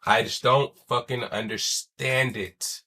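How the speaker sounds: usual speaking voice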